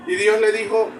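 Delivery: ordinary talk, nothing marked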